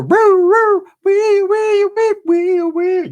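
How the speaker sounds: high, loud mock police siren, roo roo wee wee; siren imitation. A girlfriend who calls cops, a high mock police siren. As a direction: wail it out like a cop car siren.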